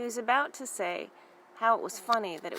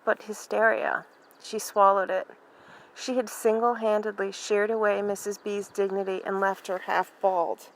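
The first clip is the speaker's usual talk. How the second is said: usual speaking voice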